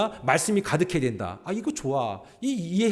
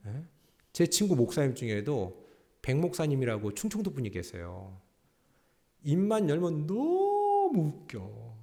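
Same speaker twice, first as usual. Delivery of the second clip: ordinary talk, nothing marked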